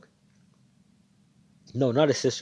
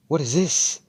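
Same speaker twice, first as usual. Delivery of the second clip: startled question; 8 semitones higher, 9 dB louder than usual. Retold or imitated. Companions see him grow.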